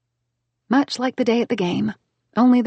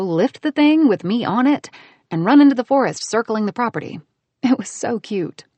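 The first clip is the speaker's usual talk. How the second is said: usual speaking voice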